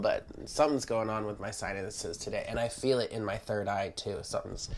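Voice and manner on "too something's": ordinary talk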